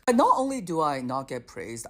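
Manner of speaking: ordinary talk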